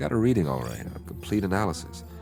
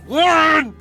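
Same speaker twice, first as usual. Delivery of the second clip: very high, loud scream of a name; anguished scream; 8 dB louder than usual. Acted. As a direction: scream for a friend who's gone. Screaming dead crewmate's name.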